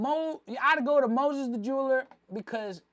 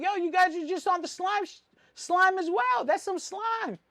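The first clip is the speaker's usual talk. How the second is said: retold call-out; 9 semitones higher than usual. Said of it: "then I was like".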